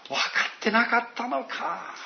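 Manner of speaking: put-on a parent sighing at a child; parental dismay. Parent lovingly chiding a child, gentle dismay. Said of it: "そういうふうな感じなんですね".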